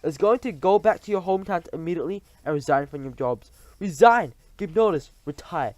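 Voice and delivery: loud, high commanding witch; thundering. Acted. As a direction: hammer each order home. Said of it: "thundered the Grand High Witch".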